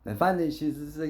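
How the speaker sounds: normal talking voice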